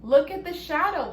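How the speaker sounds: ordinary delivery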